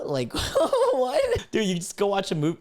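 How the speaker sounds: high, laughing incredulity; cracking up in disbelief. Real, not acted. Laughing at his own mock freak-out.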